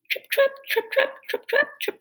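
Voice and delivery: high, light trip-trap; dainty trotting sound; tiny hoof steps. Acted. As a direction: tap out little hooves. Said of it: "went his tiny hooves".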